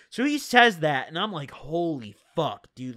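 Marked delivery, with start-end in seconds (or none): 1.5-3.0 low, slow, awed murmur; stunned hush. Retold or imitated. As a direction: breathe it out in disbelief. Stunned reaction again.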